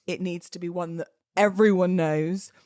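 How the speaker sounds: normal talking voice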